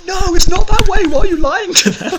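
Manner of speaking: very high, loud hammed-up parental shout; parents' outburst. Retelling the French parents scolding their lying kid, loud. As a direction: blast it like outraged parents. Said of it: "his parents just went".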